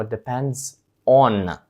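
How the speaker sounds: "depends on" said very slowly and clearly; correct form, slow. Shown as a demonstration. Teacher giving the correct form after the wrong one. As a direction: lean on "on" in "depends on". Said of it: "Англійською ми скажемо".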